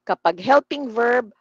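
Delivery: ordinary talk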